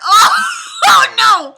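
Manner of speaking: high, loud laughing wail; dismayed wail. Real, not acted. Reader appalled by the book's wording, loud wailing dismay. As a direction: cry out in comic horror.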